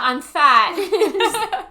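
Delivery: high, very loud imitated shout; mock yell. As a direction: holler it like a daughter at mum. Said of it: "she yells out".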